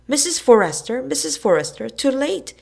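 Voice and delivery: loud, high, triumphant calling; mock-gloating kid shouting from the window; gleeful yell. A narrator's reading. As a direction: crow it out with glee. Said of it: "yelled Billy gleefully".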